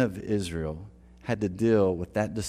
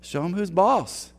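mock slacker buddies, higher; 8 semitones higher, slower than usual. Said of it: "And they're like".

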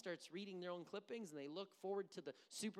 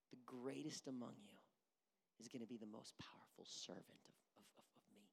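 whisper; 7 semitones lower, 9 dB quieter than usual. Real, not acted. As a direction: drop to an unvoiced whisper. Preacher drops to whisper.

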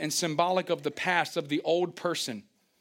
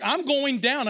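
mock panic; 8 semitones higher, 5 dB louder, slower than usual. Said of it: "and I'm like".

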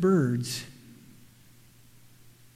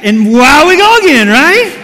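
much higher and louder, thrilled; excited burst; 7 semitones higher, 13 dB louder than usual. Real, not acted. Preacher whooping at the circle of life, loud and thrilled. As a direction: let it explode with delight. Worm cycle punchline.

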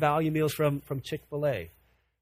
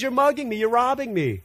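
God's accusation; 7 semitones higher than usual. Retold or imitated. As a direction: insist on it. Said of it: "but he says".

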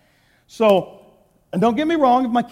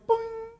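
imitated notification ping; 14 semitones higher, 8 dB quieter than usual.

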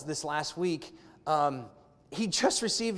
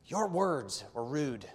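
slow stern rebuke; 4 semitones lower, 5 dB quieter, much slower than usual. Pretend. Imagined rebuke of son.

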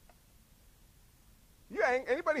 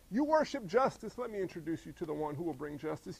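usual speaking voice

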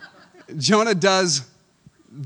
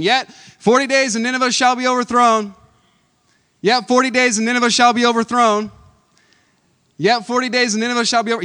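high, loud repeated street proclamation; crying it out; 8 semitones higher, 9 dB louder than usual. Acted. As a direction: call it through the streets again and again. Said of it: "walking through the city crying it".

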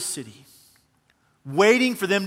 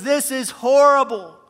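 higher, louder mock anguished cry; 6 semitones higher, 6 dB louder than usual. Jonah complains to God in the heat, mock anguished cry. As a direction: wail it to the sky in mock misery. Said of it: "Jonah cries out".

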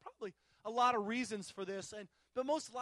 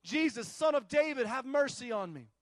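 loud, raised cry over a crowd; imitated yelling; 7 dB louder than usual. Blind man yelling over a crowd to Jesus, imitated yell. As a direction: call it out as the yelling beggar, not full scream. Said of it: "This is what he's yelling".

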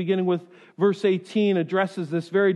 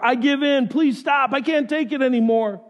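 higher-pitched begging to make it stop; acted pleading surrender; 6 semitones higher than usual. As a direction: plead as if you can't bear it. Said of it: "Crying uncle is an Americanism for".

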